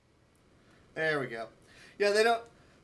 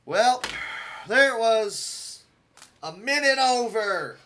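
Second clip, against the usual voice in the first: louder, slow, dragged-out disappointment; loud exasperated groan; 4 semitones higher, 9 dB louder, slower than usual. Real, not acted. A gamer missing his target time by a minute, loud and fed up. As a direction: let the frustration spill out loud and slow.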